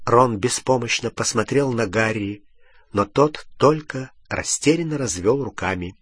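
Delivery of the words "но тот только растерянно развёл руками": ordinary delivery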